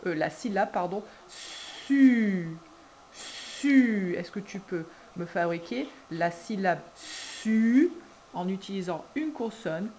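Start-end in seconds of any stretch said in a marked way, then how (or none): 1.3-4.2 "su" stretched, long hissed S into a loud French U; insisted syllable sound. Shown as a demonstration. Dictating a syllable to a child, "su" insistently drawn out. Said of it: "n'hésitez pas à insister". 7.0-7.9 "su" stretched, long hissed S into a loud French U; insisted syllable sound. Shown as a demonstration. Dictating a syllable to a child, "su" insistently drawn out. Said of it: "n'hésitez pas à insister".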